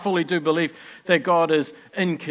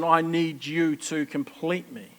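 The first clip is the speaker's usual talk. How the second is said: ordinary talk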